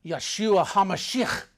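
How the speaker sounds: said loud. Retold or imitated. Telling a girl in Hebrew, loud and slow. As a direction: call the Hebrew name out boldly. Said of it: "just said it loud".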